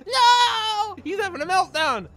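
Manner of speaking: high fake crying wail; mock meltdown. Gamer faking a meltdown, high mock wail. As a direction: ham up a tantrum.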